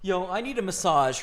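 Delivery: loud, calling across the room. Real, not acted. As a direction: call out loudly to her. Loud call to Rebecca.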